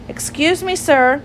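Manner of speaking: high, polite call for attention; storybook child, addressing an elder; polite little girl. Acted. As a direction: politely hail a gentleman in a high voice. Lucy politely addresses Jeremy Fisher.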